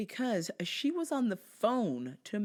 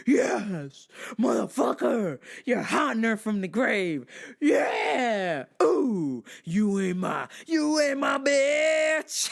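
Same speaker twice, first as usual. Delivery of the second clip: loud cheering shout at the grave; triumphant yell; 6 semitones higher, 9 dB louder, slower than usual. Retold or imitated. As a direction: yell it like a victory cry. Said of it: "I went".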